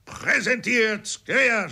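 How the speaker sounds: high, slow parade-ground shout; shouted command. A narrator's reading. Corporal shouting drill orders at himself, loud. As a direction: yell the order across the drill square. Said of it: "schrie der Korporal".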